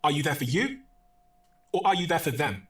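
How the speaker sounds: emphatic question with a held silence in the middle; deliberate silences. Shown as a demonstration. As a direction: ask "for you" rising, leave a silence, answer with "for them". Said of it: "you take silences that most people".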